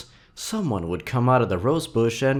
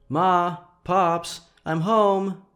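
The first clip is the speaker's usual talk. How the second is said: calling out; 9 semitones higher, much slower than usual. Acted. Calling out on arriving home.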